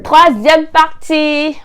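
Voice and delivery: high, loud, drawn-out title call; section announcement. Pretend. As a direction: belt out the title slowly and high.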